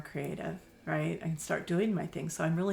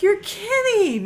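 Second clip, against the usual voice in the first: much higher, louder and slow, retelling her own disbelief; surprised; 11 dB louder, slower than usual. Retold or imitated. Owner telling the caller she can't believe it, surprised. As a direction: stretch it out in happy shock. Retold reply to free offer.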